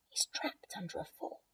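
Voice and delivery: hushed whisper; whispered child. Acted. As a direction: whisper the worry.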